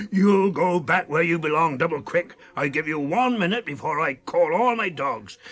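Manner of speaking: loud gruff warning; threatening. Retold or imitated. Maggot retelling his threat to the rider, loud and gruff. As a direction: threaten him off the farm.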